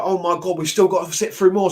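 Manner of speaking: high and loud, fed-up complaint; exasperated groan. Retold or imitated. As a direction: sound worn out by it all. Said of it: "And I was like".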